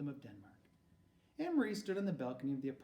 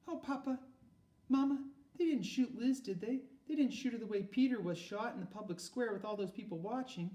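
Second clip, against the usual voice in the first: put-on high, louder, frightened pleading girl; pleading fear; 10 semitones higher, 5 dB louder than usual. Daughter begging her parents, high and fearful. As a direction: beg to hear it isn't true.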